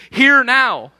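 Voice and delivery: loud, high, commanding rebuke; acted anger. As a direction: call a crowd to heel as a provoked leader. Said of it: "He's angry".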